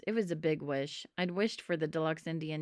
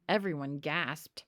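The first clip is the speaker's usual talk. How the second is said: plain reading voice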